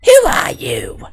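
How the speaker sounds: slow, loud breathy whisper-shout. Pretend. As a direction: hiss it out like a startled scientist. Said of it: "a very excited, high-pitched voice".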